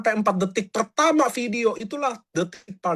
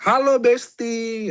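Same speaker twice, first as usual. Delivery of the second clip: chummy greeting; 4 semitones higher, 4 dB louder, much slower than usual. Retold or imitated. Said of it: "Itu pasti di-skip".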